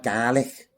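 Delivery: Gaelic tried as GAL-ick, like an accent; accented attempt. Shown as a demonstration. Learner copying her Scottish friend, Gaelic as GAL-ick. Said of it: "It sounds like an accent".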